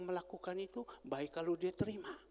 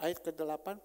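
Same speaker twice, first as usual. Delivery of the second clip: ordinary talk, nothing marked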